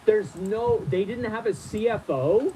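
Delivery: high-pitched disbelief, drawn out. Real, not acted. Reader stunned by the missing C F O, incredulous. Shocked reaction to letter.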